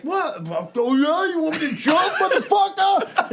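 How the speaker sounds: high, loud, laughing taunt, slowing at the end; cocky drunk taunt. Retold or imitated. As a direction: dare them, half laughing.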